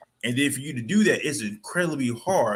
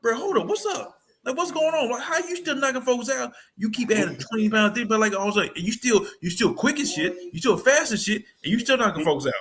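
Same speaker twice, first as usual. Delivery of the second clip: incredulous fans; 9 semitones higher than usual. Retold or imitated. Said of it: "folks thinking like".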